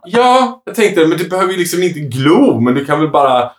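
mock sister voice. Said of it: "och hon bara".

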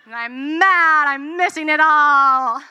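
loud, slow, frustrated declaration; angry outburst. Retold or imitated. Stuck in city traffic, late to wedding, loud slow angry venting. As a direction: let the anger spill out slowly and loudly.

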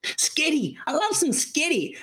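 high, babyish mimicry of the coworker; mock baby talk. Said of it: "you are talking like a baby".

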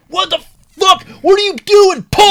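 high, loud enraged yell; imitated furious screaming; screaming cop. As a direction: scream it like a cop losing it at a driver. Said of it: "he's just screaming".

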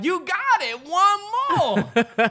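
high, loud cheer from the crowd; mock crowd cheering. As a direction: egg him on like the whole crowd.

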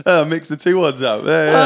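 high laugh into the words. Real, not acted. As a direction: laugh through it. Laughing at own slip.

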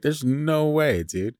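high, drawn-out mock disbelief; playful disbelieving protest; incredulous. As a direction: stretch it out high in disbelief, dropping on the last word. Acts out own disbelief at picture.

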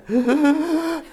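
high, shaky imitated breath of a child; wobbly child gasp. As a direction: mimic a little girl's trembling inhale. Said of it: "they got that waver going".

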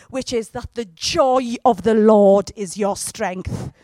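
usual speaking voice

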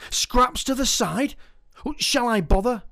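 put-on child voice pondering chip-shop scraps; child in the queue. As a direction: wonder aloud like a kid.

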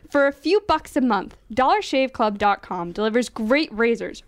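upbeat radio ad read. Acted. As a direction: sell it like a radio spot. Reads a sponsor ad aloud.

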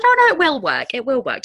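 high, brightly reassuring midwife voice; imitating a cheery midwife; soothing midwife. Midwife reassures labouring mother.

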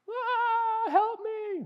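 put-on squeaky toddler whine; whiny toddler. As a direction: play the frustrated three-year-old pleading for help. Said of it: "not being able to get the lid off the toothpaste".

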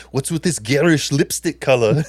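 slow put-on Arnold Schwarzenegger voice; Arnold impression. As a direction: drawl it out like Arnold in the trailer. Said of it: "repeat everything Carrie just said as Arnold Schwarzenegger".

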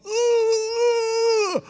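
high strangled choking cry; a desperate gagging wail from a blocked throat. Retold or imitated. Choking on swallowed penny.

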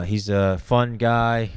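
ordinary talk, nothing marked

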